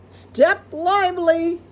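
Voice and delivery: loud, high pirate order; pirate yell. A narrator's reading. The boy orders the crew around, yelling. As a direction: bark the order like a ship's captain. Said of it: "Nick yelled".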